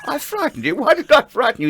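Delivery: retold laughing disbelief. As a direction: ask it through laughter, amazed.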